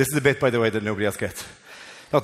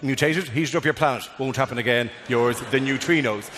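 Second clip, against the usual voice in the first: lower, mock-apologetic read; a guilty partner saying sorry, a touch lower; sheepish apology note; 7 semitones lower than usual. Neutrinos apologising in a note, lower and sheepish. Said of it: "they sent a note going".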